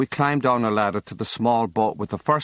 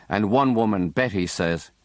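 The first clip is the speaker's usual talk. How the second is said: ordinary delivery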